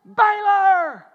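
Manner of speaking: loud, high call to the dog; big drawn-out shouted name; full-out holler. Retold or imitated. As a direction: holler the name across the yard. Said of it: "this morning I heard".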